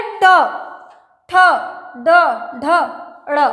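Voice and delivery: ट, ठ, ड, ढ said with the tongue curled, then released with a jerk; curled-tongue letters. Shown as a demonstration. Teacher demonstrating the retroflex letters, sharp release. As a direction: flick each retroflex sound out sharply. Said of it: "मुड़ के फिर तुरंत झटके से बोल".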